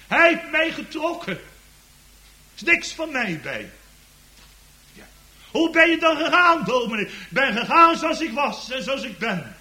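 loud, high-pitched pulpit proclamation; impassioned preaching. Real, not acted. Preacher testifies how Christ drew a lost sinner, impassioned. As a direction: preach it at full voice, pressing on the key words.

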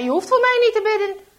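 loud, high, fast rebuff; angry refusal. A narrator's reading. Traitor refuses dying Henk's prayer, angry shout. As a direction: fling the offer back, shouting.

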